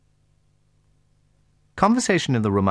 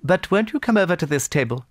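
higher, louder, more urgent invitation; insistent hostess; 13 semitones higher, 5 dB louder than usual. A narrator's reading. Anna Pavlovna repeats her plea to Pierre, insistent. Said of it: "repeated Anna Pavlovna".

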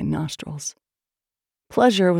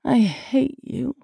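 high, slow, drawn-out plea; pleading; 7 semitones higher, much slower than usual. A narrator's reading. Woman tells her lover she hates him, pleading. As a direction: let hatred turn into begging. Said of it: "It sounded like a plea".

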